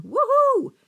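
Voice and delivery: loud, very high whoop; cheering whoop. A narrator's reading. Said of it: "Applause filled the room as I heard".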